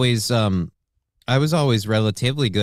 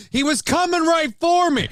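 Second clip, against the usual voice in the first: much higher, squealed protest; hammed-up high-pitched alarm; mock panicked excuse. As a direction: plead a silly self-defense excuse. Acts out shooter's excuse about dog.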